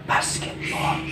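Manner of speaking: breathy unvoiced whisper. Shown as a demonstration. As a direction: whisper the word softly. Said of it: "I'm going to whisper a two-syllable word".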